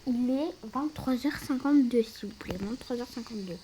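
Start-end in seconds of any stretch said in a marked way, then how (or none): none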